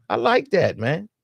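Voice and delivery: usual speaking voice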